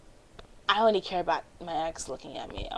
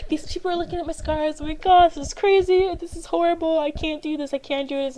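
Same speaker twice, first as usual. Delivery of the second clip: high, rushing panic, rising alarm; freaking out in her head; panicked inner voice; 10 semitones higher, 5 dB louder than usual. Retold or imitated. To herself in the mirror, high-pitched panic. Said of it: "And I'm freaking out".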